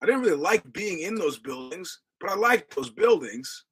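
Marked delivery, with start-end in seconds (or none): none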